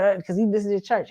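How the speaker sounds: ordinary talk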